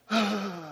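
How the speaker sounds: big breathy relieved sigh; sigh of relief. Retold or imitated. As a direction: let out a long sigh after a scare. Acts out relief after scare.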